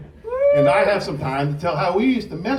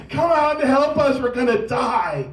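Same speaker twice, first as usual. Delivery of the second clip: mock dying plea; 7 semitones higher than usual. Brothers faking dying, begging.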